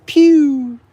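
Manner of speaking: high, loud squeaky "Pew!"; a sharp, high cartoon pew for the bunny; bunny noise.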